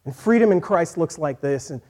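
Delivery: ordinary talk, nothing marked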